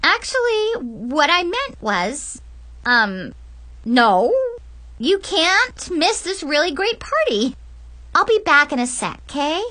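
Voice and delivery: high, falsely sweet voice with halting gaps; strained perkiness, regaining composure; forced cheer. Acted. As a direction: cover the panic with a fake smile. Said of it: "plastered a fake smile on my face".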